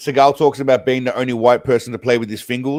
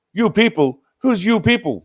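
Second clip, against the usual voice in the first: indignant; 8 semitones higher than usual. Retold or imitated. Mock indignant retort about labeling.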